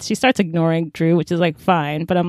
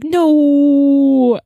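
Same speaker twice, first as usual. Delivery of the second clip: higher, louder melodramatic no; mock anguished no; 6 semitones higher, 7 dB louder than usual. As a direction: wail it like a soap hero. Drew's mock reaction to her leaving.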